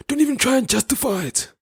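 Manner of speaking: very high falsetto mimicry; imitating taxi-rank guy. Retelling a taxi-rank argument to listeners, high-pitched imitation. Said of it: "he said that".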